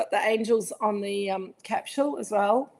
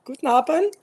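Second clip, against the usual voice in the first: put-on higher, louder German-accented greeting; 5 semitones higher, 5 dB louder than usual. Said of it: "I do the accent very well".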